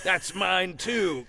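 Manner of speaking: high, slow, drawn-out mock gloating; mock-greedy claim. Host cheering on claiming every audition, mock-greedy. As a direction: stretch it out in a high comic voice.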